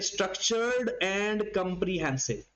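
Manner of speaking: structured and comprehensive drawn out very slowly, word by word; slow dictation. Shown as a demonstration. Teacher dictating a syllabus term, very slow. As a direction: dictate the phrase slowly for note-taking. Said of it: "structure का मतलब है system होना चाहिए".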